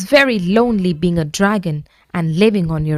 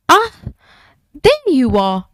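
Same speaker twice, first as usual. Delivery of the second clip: panting; 8 semitones higher, 3 dB louder than usual. A narrator's reading. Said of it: "panted a voice".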